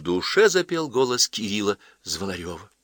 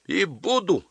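tearful shouting; 12 semitones higher, slower than usual. Acted. Daughter defies her mother, tearful cry. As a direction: insist defiantly through acted tears. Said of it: "сквозь слёзы закричала Ася".

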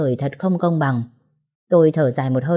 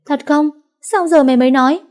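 much higher and louder excited yell; yelling in surprise; 10 semitones higher, 6 dB louder than usual. Acted. Giang hears her friend is back home, an excited yell. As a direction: shriek it out, thrilled. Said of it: "con Giang đã gào ầm lên".

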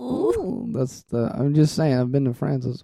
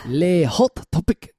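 slow, strained mock French accent; constipated French accent; 3 semitones higher, slower than usual. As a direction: push out "Le hot topic" slowly in a strained fake French voice. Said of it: "That's my constipated French accent".

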